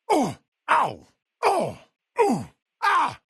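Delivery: short, high hurt cries; pain yelps. Acted. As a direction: react to repeated hits. Grunts of pain when hit.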